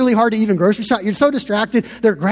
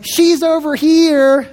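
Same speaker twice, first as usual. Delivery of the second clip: higher, slow drawn-out call; stretched-out holler, mildly raised; 6 semitones higher, much slower than usual. Retold or imitated. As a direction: imitate a woman calling from a few aisles over. Lady calling from aisles away.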